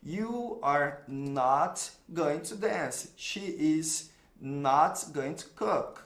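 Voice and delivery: slow, word-by-word English example; slow teaching model. Shown as a demonstration. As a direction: spell each word out slowly for students. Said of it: "só vou acrescentar um not". (frase modelo bem devagar)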